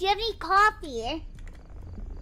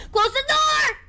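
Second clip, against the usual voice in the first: loud, high yell; high, loud command to close the door; 9 semitones higher, 5 dB louder, slower than usual. Real, not acted. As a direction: bark it out across the room. Yelling at game character.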